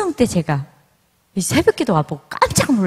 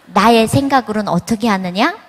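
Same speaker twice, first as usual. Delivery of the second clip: usual speaking voice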